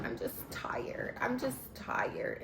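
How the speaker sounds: slow tired whisper, holding back tears; weary whisper. Retold or imitated. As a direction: breathe it out, worn down. Tearful Angel brushing off Kay.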